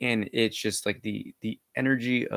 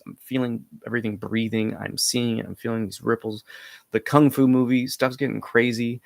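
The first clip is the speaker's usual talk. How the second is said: ordinary delivery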